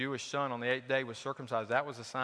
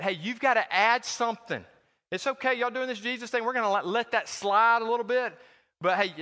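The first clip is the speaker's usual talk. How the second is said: higher, louder, smug mock teacher; mock Judaizer; 6 semitones higher, 4 dB louder than usual. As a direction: wave it through like a condescending legalist. Mocking the Judaizers' claim.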